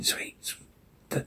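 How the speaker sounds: distorted ghostly whisper. Acted. Daddy's warped voice luring Jane, whispered. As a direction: breathe it out, eerie and warped. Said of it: "Dad distorted voice".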